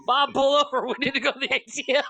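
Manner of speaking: high, fast and laughing, calling to the driver; a giddy kid begging Bob to stop at the ATM; excited kid. Pretend.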